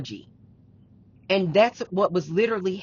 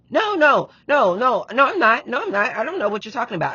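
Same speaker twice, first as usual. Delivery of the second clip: loud, higher, rapid-fire string of denials; raised-voice imitation of someone refusing to hear it; imitated defensive denial; 5 semitones higher, 5 dB louder than usual. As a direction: protest over and over, mimicking a defensive partner. Voices partner's insistent denial.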